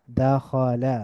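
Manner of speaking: দাখালা slowly as da, kho, la; syllable by syllable. Shown as a demonstration. Teacher breaking down the word, দাখালা syllable by syllable. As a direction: split দাখালা into three drawn-out syllables. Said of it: "একটু মিলিয়ে মিলিয়ে পড়ব".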